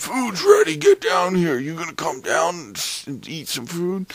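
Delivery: very high, loud mock hollering; imitated background yelling. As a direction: shout like family calling someone to dinner. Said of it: "there's yelling in the background".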